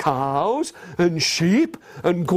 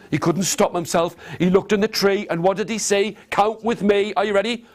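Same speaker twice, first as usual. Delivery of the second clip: ordinary delivery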